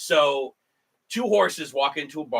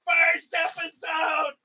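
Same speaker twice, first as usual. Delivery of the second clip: much higher shouted cheers with laughter; gleeful cheering; 13 semitones higher, 3 dB louder than usual. Real, not acted. Host celebrating the first episode wrap, gleeful shouting. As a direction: whoop it up like you've finished something.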